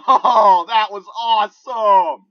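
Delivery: loud, high, drawn-out triumph with laughter; elated yelling. Real, not acted. Player celebrating two quick headshots, elated yelling. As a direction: laugh and yell it out slowly, thrilled. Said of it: "I'm sorry if I'm yelling".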